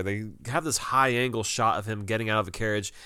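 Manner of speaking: ordinary delivery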